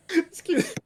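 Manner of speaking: choking with laughter, high; laughing fit. Real, not acted. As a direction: laugh until you can barely speak. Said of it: "Make me laugh so much I'm choking".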